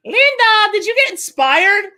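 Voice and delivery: loud, high call to someone away. Real, not acted. Streamer asking Linda if she got inspired, calling out. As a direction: holler the question across to her.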